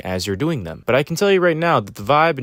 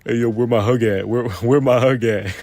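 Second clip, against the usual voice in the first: a lightly voiced pushy upperclassman asking for a hug; mock the cocky senior guy, casual and smug; hey-yo bro guy. Mocking a pushy upperclassman, a light bro voice. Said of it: "acted like that one guy who's like".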